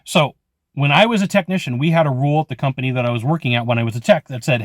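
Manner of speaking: ordinary talk, nothing marked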